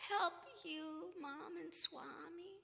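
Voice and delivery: put-on slow, high, soft child voice; dazed daughter. Daughter just awake answers her parents, dazed. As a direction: say it high and slowly, half out of it. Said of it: "like that".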